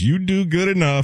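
high put-on caller voice; caller's voice. Cohost quoting a caller, high put-on voice. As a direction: mimic a patronizing caller. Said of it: "The caller says".

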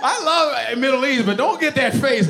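ordinary talk, nothing marked

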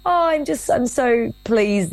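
high, a hint of the moved specialist; tearful doctor, lightly voiced. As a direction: lightly act out the doctor choking up. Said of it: "And she sort of said".

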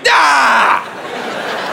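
high, drawn-out "Ah!"; startled cry. Acted. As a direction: yelp in mock horror. Reaction acted after looking down.

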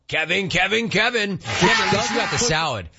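high, teasing, laughing through it; laughing tease. Real, not acted. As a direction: rib Kevin while cracking up.

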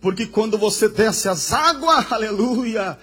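loud, fervent pulpit climax; impassioned preacher proclaiming to the congregation; full-force preaching. Real, not acted. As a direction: drive each phrase home with rising intensity.

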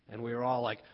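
ordinary delivery

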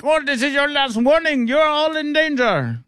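high, hammed-up Indian accent; mock Indian accent. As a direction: mimic an Iranian navy radio threat in an Indian accent. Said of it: "why that's an Indian accent".

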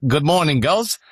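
a jokey, bad Australian voice for the dog; bad Australian accent. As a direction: greet the girls in a deliberately bad Australian accent. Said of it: "with a really bad Australian accent".

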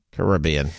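"Caribbean" said ka-RIB-ee-an; "Caribbean" with the beat on the second syllable, rib; stress on RIB. Shown as a demonstration. Radio host offering another pronunciation, "Caribbean" stressed on RIB. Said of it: "whichever way you'd prefer to pronounce it".

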